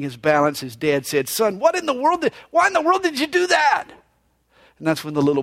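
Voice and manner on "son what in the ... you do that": higher, startled what-in-the-world; mock startled father blurting it out; startled dad. Dad startled by son's leap.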